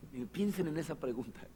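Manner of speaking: normal talking voice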